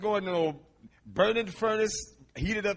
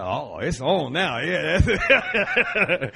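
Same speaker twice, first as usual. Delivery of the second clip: gleeful laugh; 7 dB louder, much slower than usual. Retold or imitated. She is his sister, not wife.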